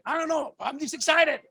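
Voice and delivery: ordinary talk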